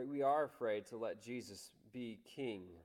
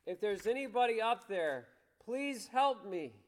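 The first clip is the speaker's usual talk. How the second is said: desperate plea; 7 semitones higher, 5 dB louder than usual. Retold or imitated. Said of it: "he says".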